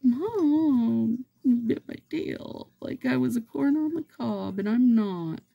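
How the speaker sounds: high-pitched whiny kitty complaining; cat whining to its mom about a bitten tail. Pretend. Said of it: "poor little Beef's like".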